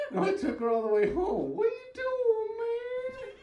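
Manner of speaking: high, sing-song mock sob; hammed-up wailing, voice way up; crying on the phone. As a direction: whine it out through fake tears. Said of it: "I called him crying".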